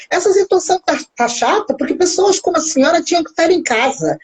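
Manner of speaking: imitando o açougueiro ríspido, bem mais agudo; repreensão imitada, voz subida; bronca do açougueiro. Retold or imitated. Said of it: "Ele me respondeu assim". (açougueiro repreendendo a cliente, imitado em tom agudo de bronca)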